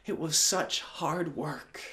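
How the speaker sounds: plain reading voice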